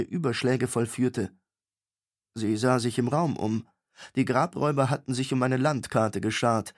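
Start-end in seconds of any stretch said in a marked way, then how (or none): none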